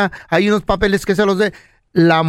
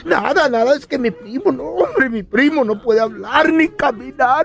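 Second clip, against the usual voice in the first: higher, whimpering excuse; pleading husband; 4 semitones higher than usual. Acted. Husband pleading his excuse to wife, whimpery. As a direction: play it meek and pitiful. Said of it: "Y el pelón".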